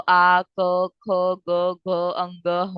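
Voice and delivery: आ क ख ग घ said one by one from the throat; throat sounds demo. Shown as a demonstration. Teacher demonstrating throat letters to students, careful sounding out. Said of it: "You guys should pronounce this right now".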